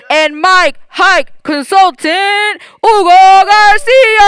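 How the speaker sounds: booming, high, drawn-out stadium announcer. Retold or imitated. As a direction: hype the name like a soccer match announcer. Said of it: "try to imitate your announcer voice".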